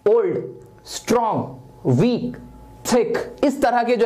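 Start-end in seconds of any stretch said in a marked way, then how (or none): 0.1-2.4 old, strong, वीक each as one isolated sound; each word in one go, pauses between; one-syllable demo. Shown as a demonstration. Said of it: "एक बार में आवाज निकल जाए".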